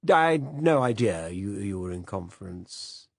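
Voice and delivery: a fussy, absent-minded British gent apologizing; hesitant upper-class old man, drawn out; vague old Director General. Acted.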